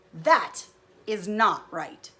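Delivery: slow, loud, indignant Gerald; a cross elephant protesting loudly; mad Gerald. Acted. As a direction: stamp each word out slowly. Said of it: "then Gerald gets mad".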